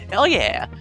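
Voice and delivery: high thrilled shout; excited cheer. Acted. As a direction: cheer it out, delighted. Tiger cheers at being picked.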